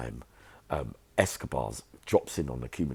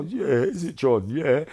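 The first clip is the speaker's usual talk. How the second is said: mocking; 11 semitones higher, 6 dB louder, slower than usual. Mocking the men caught out.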